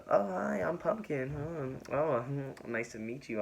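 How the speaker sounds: high-pitched introduction, softening as it goes; lightly mimicked high greeting; Pumpkin. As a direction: imitate a girl introducing herself in a high voice. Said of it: "Pumpkin was all like".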